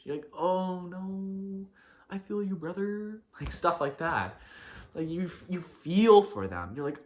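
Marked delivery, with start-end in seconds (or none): 0.4-3.2 high, drawn-out pitying lament; sad cringe. Acted. Sympathizing with a brother who struck out, acted sad cringe. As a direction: commiserate with a pained, mock-sad whine. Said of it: "like, sad cringe".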